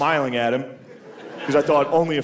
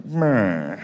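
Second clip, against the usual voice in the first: low dismayed announcer; 5 semitones lower than usual. Retold or imitated. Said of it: "You can hear the announcers like".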